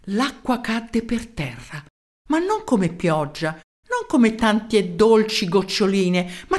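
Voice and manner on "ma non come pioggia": ordinary delivery, nothing marked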